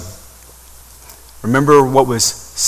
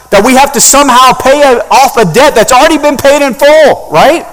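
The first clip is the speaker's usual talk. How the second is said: impassioned preaching; 9 semitones higher, 6 dB louder than usual. Acted. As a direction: preach it to the back row. Preaching at full force.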